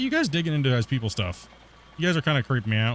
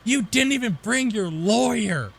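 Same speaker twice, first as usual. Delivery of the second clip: higher, slower sneering caricature; mock taunting officer; 8 semitones higher, slower than usual. Border officer taunting him, high and slow.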